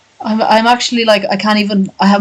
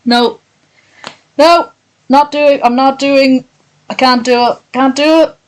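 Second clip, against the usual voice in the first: higher, insistent string of refusals; emphatic refusal; 6 semitones higher, 3 dB louder than usual. Real, not acted. Streamer refusing to read any further, high and emphatic. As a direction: refuse urgently, pitched up and repetitive.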